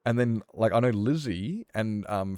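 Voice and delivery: ordinary talk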